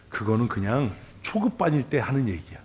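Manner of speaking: normal talking voice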